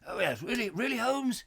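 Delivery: soft, bumbling Watson impression; hesitant, muffled bumbler; Nigel Bruce. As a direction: dither like a baffled old-film sidekick. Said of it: "Nigel Bruce. As".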